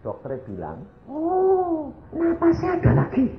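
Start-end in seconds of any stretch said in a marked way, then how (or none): 1.1-3.3 high-pitched gasp of amazement; astonished doctor. Retold or imitated. Doctor sees the husband breathing again, astonished. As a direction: react in high-voiced astonishment. Doctor's startled reaction, retold.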